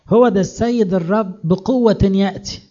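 high, loud, slow declaration; ringing herald's announcement; forceful proclamation. Acted. Proclaiming verse to the people.

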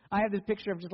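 usual speaking voice

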